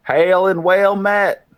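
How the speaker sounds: loud, high, drawn-out hail; long ringing greeting to a far-off stranger; called-out greeting. Acted. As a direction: call slowly across a distance, pitched high. Calling greeting to distant figure.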